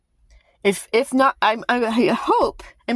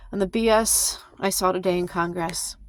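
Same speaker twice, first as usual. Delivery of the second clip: whisper. Real, not acted. Confiding aside after a rant.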